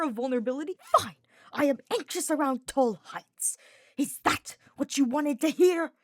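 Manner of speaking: spat furiously. Acted. Hubert lashing out at Sylvain about his fear, furious and loud. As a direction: spit the words at him in frustration. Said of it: "Hubert spat".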